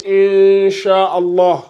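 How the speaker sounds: "Inch'Allah" with the n hidden in a long, drawn-out hum; slow recitation of "Inch'Allah", n melted away; ikhfa dissimulation. Shown as a demonstration. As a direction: hold the hidden n of "Inch'Allah", very slowly. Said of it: "Ikhfa, c'est la dissimulation".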